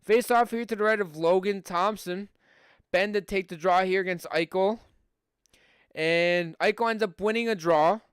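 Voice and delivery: brisk hockey play-by-play call; live play-by-play. Acted. Streamer calling the hockey game for his viewers, live play-by-play.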